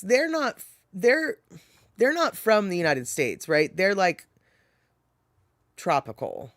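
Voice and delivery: usual speaking voice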